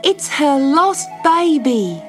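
high, slow, joyful exclaim; girl seeing the kangaroo find its baby; excited cry. Acted. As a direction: let the delight ring out. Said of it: "she cried".

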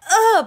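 high, loud groan of irritation; sudden frustrated yelp; pained grunt. Real, not acted. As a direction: let out a sharp, high "ugh". Something in eye.

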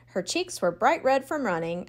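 plain reading voice

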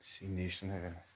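soft, low tour guide murmur; hushed voice. Real, not acted. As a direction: keep it hushed, wary of the area. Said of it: "eu vou falar baixinho".

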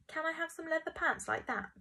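put-on high, pleading child's request; high-pitched voiced begging. A narrator's reading. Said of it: "begged Ambrose".

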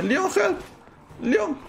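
very high-pitched call to Leon; cry for help. Pretend. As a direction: squeal for your game partner to help. Calls companion when in danger. (grito agudo pidiendo ayuda)

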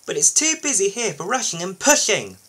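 high, sulky complaint; grudging, cross lorry voice; sour. A narrator's reading. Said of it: "said Isabella sourly".

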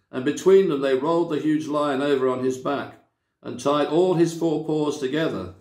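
usual speaking voice

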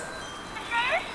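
a ghostly far-off echo; echoed repeat. Acted. Echo flower repeating the question, faint and far away. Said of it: "It's an echo flower".

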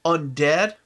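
ordinary talk, nothing marked

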